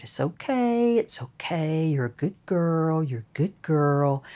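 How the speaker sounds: very soft, soothing reassurance; calming a dog. Retold or imitated. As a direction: murmur it gently to settle a tense dog. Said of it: "trying to calm the dog".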